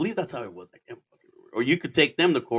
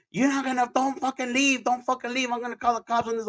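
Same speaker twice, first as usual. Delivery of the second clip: a much higher, half-hearted mock yell at the driver; retold yelling; 12 semitones higher than usual. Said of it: "the guy started yelling at me".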